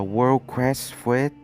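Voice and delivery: ordinary talk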